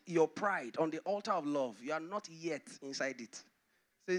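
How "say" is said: normal talking voice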